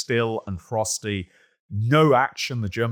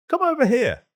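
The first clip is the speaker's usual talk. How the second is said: a much higher call thrown out across the dark; sergeant calling back; 5 dB louder than usual. Retold or imitated. British sergeant calls back toward the German lines, raised and much higher. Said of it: "one of our sergeants repeated the request".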